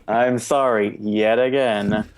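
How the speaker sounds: slow, laughing, half-hearted apology; insincere apology. Real, not acted. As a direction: apologize as if you don't really mean it. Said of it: "That's not sincere".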